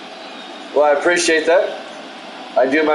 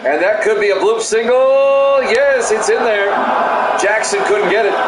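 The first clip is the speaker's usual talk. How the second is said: excited call; 5 semitones higher than usual. Real, not acted. Announcer calling a bloop single for listeners, excited shout.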